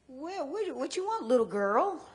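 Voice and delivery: high, surprised gruff trucker; high-pitched voicing of the trucker; trucker's voice. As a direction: voice the big trucker finding a child. Trucker voiced in retold story.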